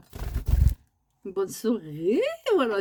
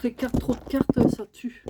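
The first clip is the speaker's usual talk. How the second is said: ordinary talk, nothing marked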